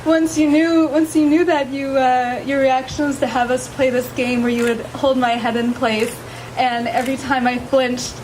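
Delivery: nervous laughing vows; shy, giggling through her vows; saying wedding vows. Real, not acted. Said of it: "would you please share your vows".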